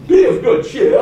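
high, loud mock English accent; hammed-up Englishman, high and hearty. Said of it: "say in the English accent".

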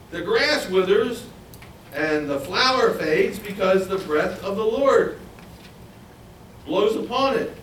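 lightly voiced lament. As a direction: sigh it out like a weary prophet. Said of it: "And then he says".

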